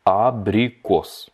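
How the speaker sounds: "Abrikos" drawn out syllable by syllable; slowed-down model. Shown as a demonstration. Teacher breaks down the Russian for apricot, very slow. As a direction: stretch "A-bri-kos" very slowly for learners.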